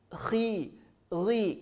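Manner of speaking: KHI and RI with a straight i; no rounding in KHI, RI; throaty "khee", "ghee" for KHI, RI; light khi, ghi. Shown as a demonstration. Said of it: "paling rendah adalah ketika baris bawah".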